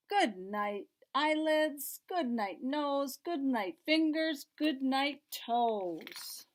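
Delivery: bouncy sing-song read-aloud; reading to children. Acted. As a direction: read it like a bedtime rhyme book. Reads bedtime rhyme.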